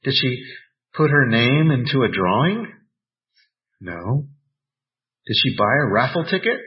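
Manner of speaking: ordinary talk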